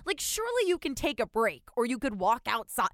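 retold outraged customer rant; mock indignant rant. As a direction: berate the clerk in disbelief. Retold rant at clerk, loud high.

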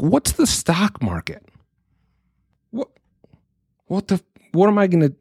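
high-pitched imitation of a puzzled child; five-year-old child. Dad mimicking his five-year-old's question, puzzled kid voice. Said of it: "explain things to my five-year-old".

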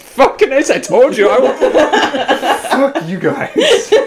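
high, laughing mock protest; words breaking up into high-pitched laughs; helpless laughter. Real, not acted. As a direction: swear at friends while cracking up. Loses laughing contest to punchline.

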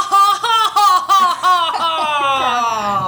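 high loud hammy villain cackle; over-the-top evil laugh, shrieking high; worst villain laugh. Retold or imitated. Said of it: "the worst villain laugh I've ever heard".

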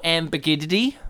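"ambiguity" as am-bi-GID-ity; teasing wrong "ambiguity", gid for gyoo; mangled pronunciation. Shown as a demonstration. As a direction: drop the "yoo" from "ambiguity", am-bi-GID-i-tee. Deliberately mangling the corrected word.

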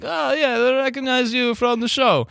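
put-on high Russian accent voice; mock Russian fan. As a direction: play the eager local fan. Fans quoted.

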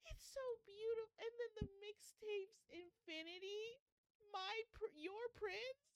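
real emotion nearly choking the words; overcome with tears. Real, not acted. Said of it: "I can't, I can't do this, guys".

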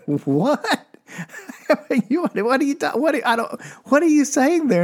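high, squeaky stunned "what?"; shocked disbelief. Retold or imitated. Comic son stunned by his mom's blunt answer, high and sputtering. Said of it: "And I was like".

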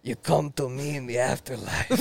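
soft godfather impersonation; mobster ghost. Acted. Friend joining the boo father bit, soft mob boss. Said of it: "one of the ghosts".

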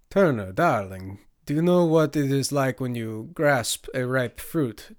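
loud, theatrical, amused lecturer; playful, projecting character voice; amused, teasing Hassan. Acted.

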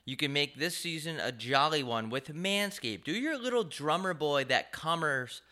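high, animated sponsor pitch; hyped ad read. Acted. Host reading a holiday sponsor spot to listeners, hyped ad read. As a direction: sell it with big swooping energy.

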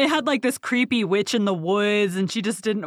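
ordinary talk

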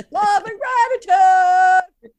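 slow, high, loud laughing shout; mock scream. Screaming love and gratitude at strangers in line, slow laughing shout. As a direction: stretch it out and yell it.

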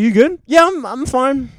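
loud retold awkward exchange. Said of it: "They're like".

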